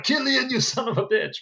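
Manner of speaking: high, shouted curse at Killian; hammed-up action-hero snarl; mock furious yell. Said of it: "come out of the screen and be like".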